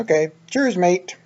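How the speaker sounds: a playful try at a Brit saying cheers; British accent. Pretend. Trying out the British greeting his friend suggested, lightly mocking. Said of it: "we're British today".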